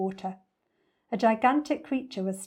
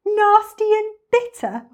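high, loud, slow indignation; outraged, drawn-out high squawk; incredulous cry; 13 semitones higher, 11 dB louder than usual. A narrator's reading. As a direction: echo it back in sheer disbelief. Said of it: "cried the enormous crocodile".

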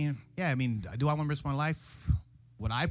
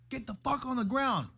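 retold cop shout; 11 semitones higher than usual. Cops shouting orders at them.

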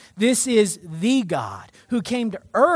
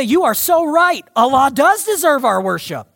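imitating eager agreement; 5 semitones higher, 6 dB louder than usual. Voicing the girl agreeing warmly.

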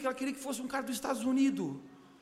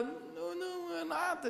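soft denial; 4 semitones higher, 6 dB quieter than usual. Retold or imitated. Mother voiced denying tears.

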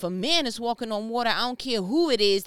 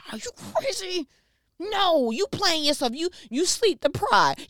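higher, louder, disbelieving mock scold; incredulous scolding; 7 semitones higher, 4 dB louder than usual. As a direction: tell your friend he's lost it. Friends scolding Peter about walking.

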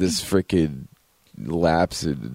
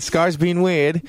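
high, drawn-out mock complaint; imitated complaining librarian; 9 semitones higher, slower than usual. Librarian reporting a visitor to security, high mock complaint. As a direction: stretch it out high like a put-on librarian.

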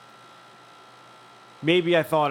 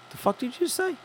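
much higher-pitched disbelieving question; incredulous challenge; 12 semitones higher than usual. Retold or imitated. Storyteller replaying his confrontation over a muttered remark, incredulous. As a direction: pitch it way up, as if you can't believe it.

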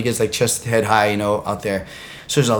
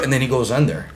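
ordinary delivery

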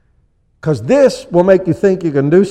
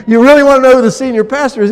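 laughing tease; 7 semitones higher, 6 dB louder, faster than usual. Real, not acted. Playful laughing question to congregation.

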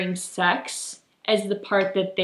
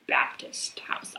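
hushed, unvoiced aside; whispering; 9 dB quieter, slower than usual. Real, not acted. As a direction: drop to a whisper for this part. Whispering a taboo detail.

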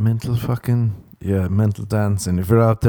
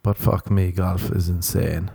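usual speaking voice

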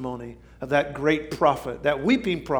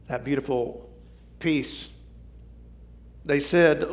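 ordinary talk

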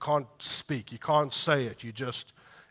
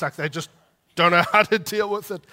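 laughing it out, higher and much louder; 5 semitones higher, 13 dB louder than usual. Real, not acted. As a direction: burst into laughter mid-phrase. Laughing at awkward spouses.

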